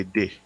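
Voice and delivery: "De" said the Jamaican way, short open e for "the". Shown as a demonstration. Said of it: "In Jamaica, you would say".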